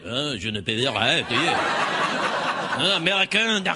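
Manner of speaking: hammed-up French accent; ridiculous French accent. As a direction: play a dismissive local with a silly French accent. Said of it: "the ridiculous French accent".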